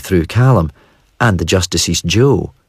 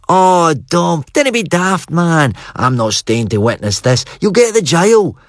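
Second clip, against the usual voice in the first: put-on boyish scolding; 11 semitones higher than usual. A narrator's reading. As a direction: tell your pal off like a teenage boy. Narrator voices young self scolding.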